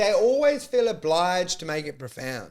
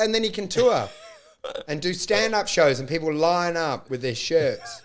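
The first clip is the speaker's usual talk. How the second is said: ordinary talk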